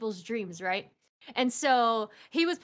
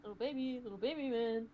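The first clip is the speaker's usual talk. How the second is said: put-on hushed, teasing baby talk; playful baby talk; 9 dB quieter, much faster than usual. Friend playing the little soft boy, lightly babyish. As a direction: coo it very softly, mock-babyish.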